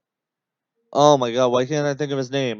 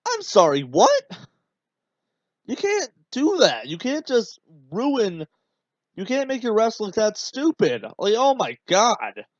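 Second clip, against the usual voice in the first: high, incredulous outburst at a bad call; exasperated, can't believe what he just saw; outraged disbelief; 7 semitones higher than usual. Real, not acted.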